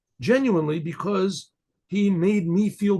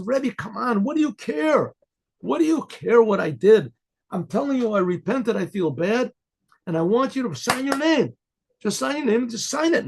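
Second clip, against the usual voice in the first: higher-pitched, exasperated pestering; insistent pleading; 6 semitones higher than usual. Retold or imitated. Man badgering the rabbi to sign his note, insistent. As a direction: press and plead like someone refusing to take no.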